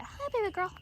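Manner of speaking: high cooing greeting; pet voice. Real, not acted. Owner greeting a shy pregnant ewe, high coo. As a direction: lift the voice way up and coo.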